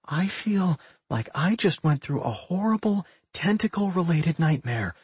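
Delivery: a touch of shakenness after a fright; shivering. A narrator's reading. Millie describes her ordeal to her friends, shivering slightly. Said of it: "she said while shivering".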